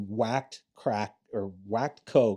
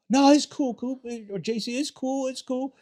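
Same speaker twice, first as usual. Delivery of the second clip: very high, hurried backing-down; placating dealer; 14 semitones higher, 4 dB louder than usual. Retold or imitated. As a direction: imitate a buddy smoothing it over, voice way up.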